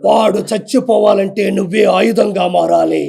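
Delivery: deep, slow, solemn prophet voice; film guru's warning. Retold or imitated. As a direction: intone it like the movie's sage. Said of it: "అదంతా చూసి".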